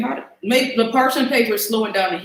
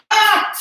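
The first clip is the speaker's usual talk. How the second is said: startled yelp; 10 semitones higher, 5 dB louder than usual. Real, not acted. Sudden startled cry mid-cooking.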